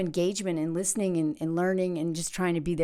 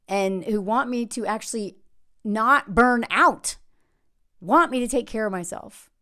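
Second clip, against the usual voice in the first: usual speaking voice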